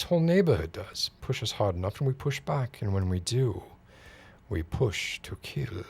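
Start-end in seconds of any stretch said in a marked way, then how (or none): 4.5-5.8 slow, whispered threat; menacing whisper. Acted. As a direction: breathe the threat out slowly. Menacing threat after build-up.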